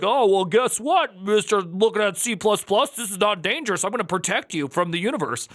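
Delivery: mocking AI voice. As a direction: lecture him like a smug nanny bot. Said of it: "it's gonna be like".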